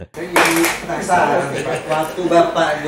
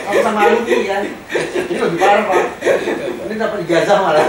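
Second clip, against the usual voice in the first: laughing; 4 semitones higher, 5 dB louder than usual. Real, not acted. Teasing jail alumni, laughing.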